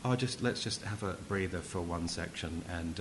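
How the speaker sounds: low, soft, offhand aside; casual breather. Acted. Mocking a film that pauses mid-action, casual and low-key. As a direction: toss it off quietly, as if pausing mid-action. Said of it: "You wouldn't go".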